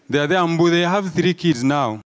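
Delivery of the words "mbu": ordinary delivery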